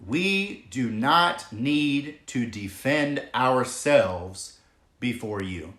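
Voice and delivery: every word spaced out slowly; slow emphatic quote. Shown as a demonstration. Preacher to viewers, slow weighty emphasis. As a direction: lay each word down slowly so none is missed. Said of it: "let me just say this again because this is so easy to miss".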